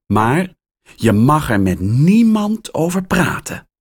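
hoger en luider waarschuwen; waarschuwend. Acted. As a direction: waarschuw hem dringend. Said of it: "waarschuwt Rita". (Rita waarschuwt Max dringend niets te vertellen)

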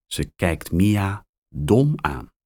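ordinary delivery

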